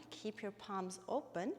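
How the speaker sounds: ordinary talk, nothing marked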